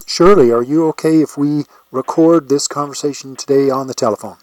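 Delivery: ordinary delivery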